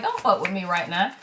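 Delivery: mock menace, said down low; face says back off. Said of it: "My face be like".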